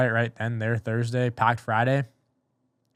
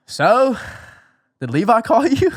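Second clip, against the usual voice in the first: imitated boss, high and loud, drawn-out; teasing question; 11 semitones higher, 9 dB louder than usual. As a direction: pitch it way up like a leading question. Acts out boss's teasing phone question.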